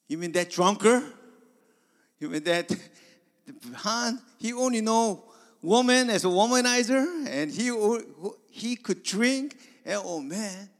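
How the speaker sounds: high, loud, laughing recall; mock the old drunk gleefully; loud amused scoffing; laughing disbelief. [name] laughing about old drunk Han.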